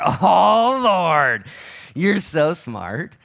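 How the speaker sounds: loud, high, drawn-out mock marvelling; hammed-up awe. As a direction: gush to God, exaggerating the wonder. Acts out his past awed delight.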